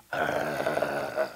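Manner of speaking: low throaty mock growl; imitated child's growl. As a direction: growl like a possessed kid. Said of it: "see a kid growl at you".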